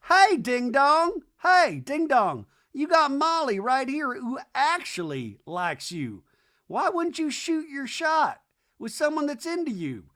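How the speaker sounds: high, slow, emphatic heckle; hammed-up exasperated advice; mock scolding. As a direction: call him out like a clueless dope. Acts Madison scolding Shep.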